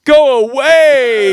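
high, loud, slow go away; frightened shout. Retold or imitated. Sister yelling at the attacker, high slow frightened shout. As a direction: stretch it out high and loud like a scared plea. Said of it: "Her sister's like".

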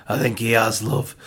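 lightly imitated gruff midwife. Midwife telling wife that dad has fainted, lightly gruff. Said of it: "just in a gruff voice".

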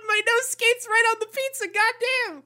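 high, excited disbelief; amazed outburst. Retold or imitated. Co-host marvelling at the ruined pizza, high and excited. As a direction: squeal it out, astonished at the pizza.

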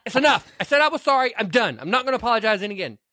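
high, hurried mock Edward; man ending the fight, in a high hammy voice. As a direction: blurt the rich man's exasperated apology. Edward quoted in argument.

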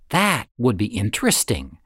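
ordinary delivery, nothing marked

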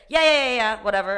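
a bored barista dismissing a customer; dismissive brush-off. Retold or imitated. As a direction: wave her off impatiently.

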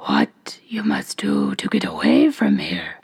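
hoarse whispering ogress; low rasping witch whisper. A narrator's reading. Ogress answers.

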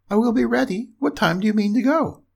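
high piglet voice; agreeable little pig. A narrator's reading. Pig agrees, asks the time.

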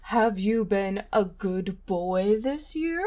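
stern Santa voice. Acted. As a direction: question him like Santa. Santa voice skit.

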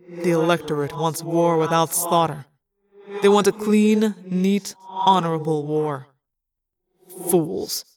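measured, contemptuous pronouncement; bitter scorn. A narrator's reading. War Prince condemns the Electorate, bitterly scornful. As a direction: weigh each word with disdain.

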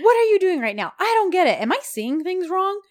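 high-pitched indignant disbelief; mock incredulous protest. Woman being carried off, high mock-incredulous protest. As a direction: question it all in high mock outrage.

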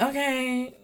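high, soft grudging okay; mildly annoyed. Retold or imitated. Grandma answering the child about candy, mildly annoyed. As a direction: give in with a touch of annoyance. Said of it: "seems annoyed by it".

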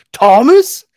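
as if seeing a ghost; shocked. Retold or imitated. Superman startled on seeing Batman's face, shocked cry.

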